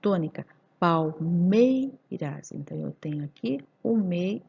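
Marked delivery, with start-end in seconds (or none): 0.8-2.5 "palmeiras" slow, with MEI drawn out; stressed syllable stretched. Shown as a demonstration. Teacher locating the tonic syllable, "palmeiras" stretched on MEI. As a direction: lean long on "mei" in "palmeiras". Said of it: "qual que é a minha última sílaba tônica".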